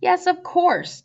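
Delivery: high, slow, delighted yes; joyful yes. A narrator's reading. Tatterhood gladly accepting the prince's proposal, high and slow. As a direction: draw out a glad yes of course.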